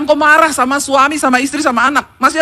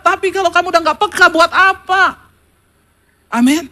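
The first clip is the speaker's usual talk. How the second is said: normal talking voice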